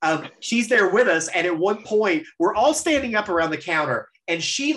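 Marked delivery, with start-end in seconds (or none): none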